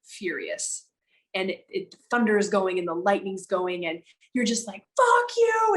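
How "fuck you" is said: high yelled curse at the sky; screaming. Retold or imitated. Someone in a storm screaming at God, furious high yell. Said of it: "and you're screaming".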